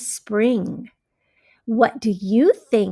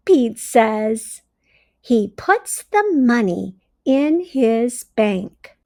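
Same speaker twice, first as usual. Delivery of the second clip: bright, expressive storybook read-aloud; reading to children; 4 dB louder than usual. A narrator's reading. Teacher reading a story to her class, expressive read-aloud. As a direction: read it like a teacher sharing a picture book.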